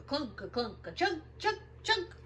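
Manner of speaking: usual speaking voice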